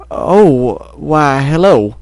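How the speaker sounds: slow, hesitant greeting; stammering. Acted. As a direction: draw out a halting hello. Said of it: "the farmer stammered".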